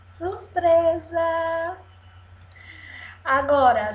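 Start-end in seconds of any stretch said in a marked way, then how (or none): 0.1-1.8 high, drawn-out "surprise!"; playful surprise. Pretend. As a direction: stretch it out like a reveal for children. Playful reveal to young pupils. (surpresa brincalhona, aguda e arrastada)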